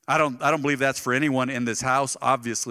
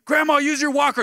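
high, loud call to grandma; exasperated shout; 11 semitones higher, 6 dB louder than usual. Retold or imitated. Grandson shouting at his stubborn grandma to use her walker, loud and high.

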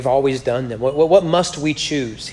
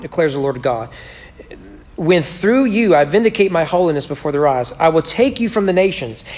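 a lightly raised, measured Bible reading; scripture reading; 4 semitones higher, 4 dB louder than usual. Acted. Preacher reads Ezekiel to the congregation, measured and lightly raised. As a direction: read the Lord's words to the church with weight.

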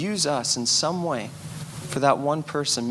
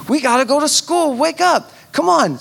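higher, louder hurry-up call; urging daughter; 9 semitones higher, 6 dB louder than usual. Retold or imitated. Dad rushing Penny to school, loud high urging. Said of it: "I said".

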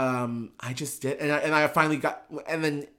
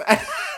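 a short high laugh. Real, not acted. Recalling hiding the money, a short high laugh. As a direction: laugh at herself.

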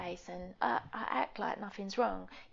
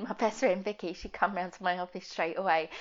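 ordinary talk

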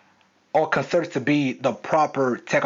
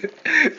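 short laugh; 13 semitones higher than usual. Real, not acted. Laughing at own retort.

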